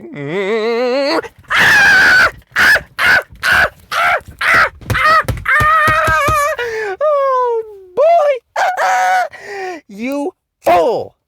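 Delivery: very high loud mock sobbing cackle; loud high hammed-up crying laugh; mocking hysterical wail. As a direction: wail at the fools over the top. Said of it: "I'm just looking at them like".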